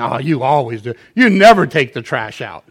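loud mock accusing spouse; hammed-up nagging complaint; imitating a nagging spouse, loud; mock accusation. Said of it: "As soon as you start saying".

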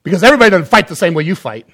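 loud, fast mock yell; husband yelling back at his wife; retold yelled retort. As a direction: fire it back defensively and fast. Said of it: "And my answer was brilliant".

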